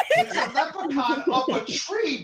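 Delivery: loud and laughing; excited insistence. Acted. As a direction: blurt it out with a laugh. Repeated exclaim.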